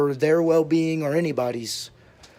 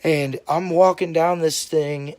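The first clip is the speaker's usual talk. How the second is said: plain reading voice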